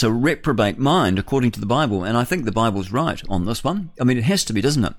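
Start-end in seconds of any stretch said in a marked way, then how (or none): none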